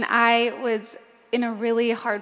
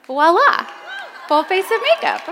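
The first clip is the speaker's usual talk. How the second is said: playful voila; 8 semitones higher, 6 dB louder than usual. Real, not acted. Punchline of the clay mask idea.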